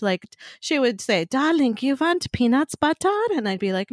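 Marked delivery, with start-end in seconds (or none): 1.2-3.1 put-on higher-pitched Slovak grandmother accent; grandmother's Zsa Zsa Gabor accent. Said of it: "more of the Zsa Zsa Gabor accent".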